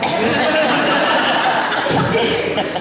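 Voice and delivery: big real laugh; bursting out laughing; loud laugh. Real, not acted. Laughs at the trafficking punchline.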